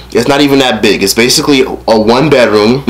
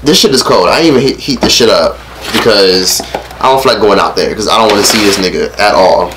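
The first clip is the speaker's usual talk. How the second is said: normal talking voice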